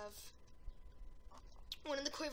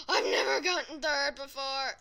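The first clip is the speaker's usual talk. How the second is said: excited shout; 10 dB louder than usual. Real, not acted. Amazed at his placing.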